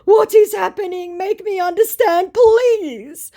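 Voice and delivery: very high, loud, hammed-up wail; confused lament. Confused scammer begging for an explanation, lamenting. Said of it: "laments my completely confused scammer".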